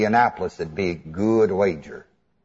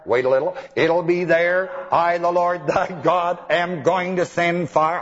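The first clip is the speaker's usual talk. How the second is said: prophesying; 5 semitones higher than usual. Acted. Preacher imitating prophets who promise fire, mock oracle intoning. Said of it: "I know what they prophesied".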